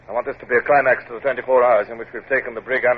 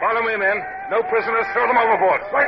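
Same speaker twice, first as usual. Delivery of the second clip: leading the boarding party with a shout; battle shout; 11 semitones higher than usual. Acted. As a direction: roar the men forward into the fight. Captain leading boarding attack.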